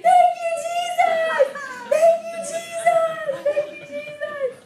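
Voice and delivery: very high, loud joyful cry, half laughing; ecstatic praise. Retold or imitated. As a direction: shout thanks to Jesus like you've lost your mind. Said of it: "they thought I was so insane".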